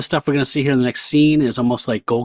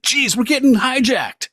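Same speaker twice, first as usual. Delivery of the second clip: high, alarmed cry of a passenger; panicked bystander shouting about hijackers; startled crew member; 12 semitones higher, 3 dB louder than usual. Acted. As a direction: yelp it out in sudden panic. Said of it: "someone's like".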